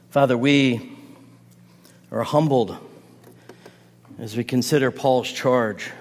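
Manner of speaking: soft, low, reverent prayer voice; quiet pastoral closing prayer, unhurried. Acted. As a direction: humbly address the Father.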